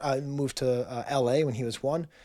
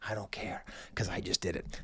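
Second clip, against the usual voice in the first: whisper; 8 semitones lower, 12 dB quieter than usual. Real, not acted. Sheepish closing admission.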